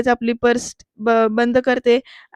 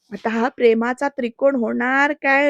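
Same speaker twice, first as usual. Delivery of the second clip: ordinary talk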